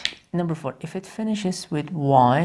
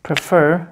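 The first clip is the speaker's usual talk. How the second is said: "prefer" said louder with the weight on fer; stress on prefer; 6 dB louder than usual. Shown as a demonstration. Teacher to class showing the stress, second syllable punched. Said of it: "for example, here".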